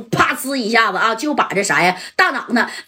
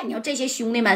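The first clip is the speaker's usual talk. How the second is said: plain reading voice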